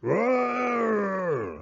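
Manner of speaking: high angry bear roar. Acted. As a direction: roar at losing the game. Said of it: "Old Bear roared".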